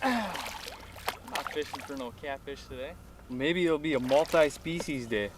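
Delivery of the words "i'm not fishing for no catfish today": sigh, then softer and slow, grumbling; playfully fed up with the catfish; mock disappointment. Real, not acted.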